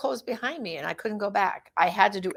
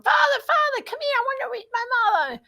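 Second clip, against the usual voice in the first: put-on little girl calling out. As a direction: mimic a small daughter shouting for the priest. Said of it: "trots yelling to Father Pavone".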